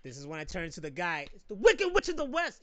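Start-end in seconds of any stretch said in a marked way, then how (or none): 1.3-2.6 high and loud, hamming it up; loud mock announcement. Telling the guy his girlfriend is a witch, loud and high. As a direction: belt it out like a joke reveal.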